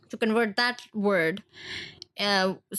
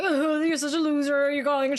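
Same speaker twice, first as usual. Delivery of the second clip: higher-pitched mock scolding; mocking friends; 6 semitones higher than usual. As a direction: imitate friends ridiculing a mistake. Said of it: "All my friends would be like".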